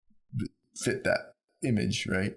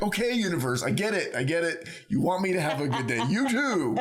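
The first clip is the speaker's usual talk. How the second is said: higher, playful exasperated surrender; mock resigned to universe; 6 semitones higher than usual. Answering the universe's repeated message, higher and amused. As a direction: tell the universe you get the message, amused.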